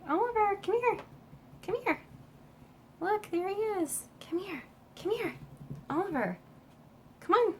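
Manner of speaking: high sing-song pet call; calling the cat. Real, not acted. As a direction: coax the cat over in a high, sweet voice.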